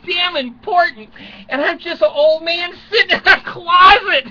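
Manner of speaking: high, laughing through the words; real giggly laugh-talk. Real, not acted. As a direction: crack up while saying it. Laughing at his own praise.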